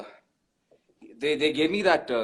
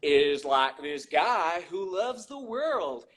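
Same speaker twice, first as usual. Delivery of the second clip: Forrest Gump. Acted. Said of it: "you also spoke about Forrest Gump".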